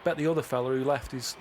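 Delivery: ordinary talk, nothing marked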